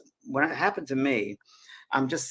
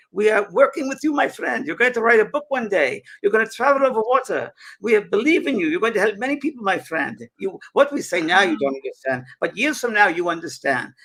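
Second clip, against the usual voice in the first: high, loud mock Frenchman calling out; French accent; 7 semitones higher, 5 dB louder than usual. French spirit at a seance calling for James, high and loud French accent. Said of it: "And he said".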